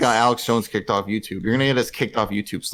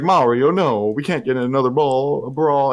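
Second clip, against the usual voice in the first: a touch higher, louder mock plea to stop; Luigi pleading; 5 semitones higher, 5 dB louder than usual. Luigi pulling Mario out of a bar fight, mock pleading. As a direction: hold your brother back from a fight. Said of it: "He's like".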